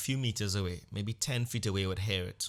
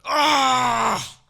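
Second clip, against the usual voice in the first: high, loud cry of pain; a loud high yelp from a twisted ankle; 12 dB louder than usual. Retold or imitated. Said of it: "Like, loud, very loud".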